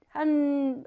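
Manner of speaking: ordinary talk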